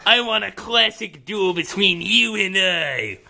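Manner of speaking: ordinary talk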